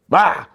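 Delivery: sharp high burst of glee; high gleeful exclamation; excited bah. Real, not acted. Excited about river fishing.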